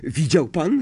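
bursting to share his first success; excited. Acted. Harry asks Lupin after his first spark, excited. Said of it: "zapytał podniecony Harry".

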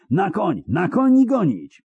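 very high, urgent command; high rallying shout; shouted order. A narrator's reading. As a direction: cry the order to mount and chase, pitch soaring. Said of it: "krzyknął Szkelen".

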